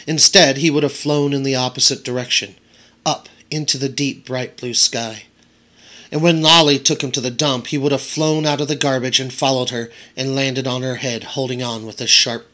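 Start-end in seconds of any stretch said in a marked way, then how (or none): none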